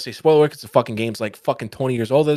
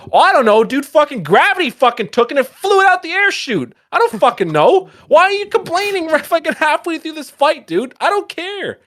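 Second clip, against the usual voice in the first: high, loud, exasperated mock retort; exasperated rant; 14 semitones higher, 5 dB louder than usual. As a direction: rant back at a whiny companion, voice shooting up. Acts out angry retort to complaining companion.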